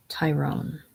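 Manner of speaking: normal talking voice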